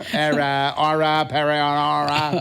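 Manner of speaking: "aura paranara" in a Boston drawl like JFK; "aura paranara" as a JFK impression; mock JFK accent. Shown as a demonstration. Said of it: "Or J F K".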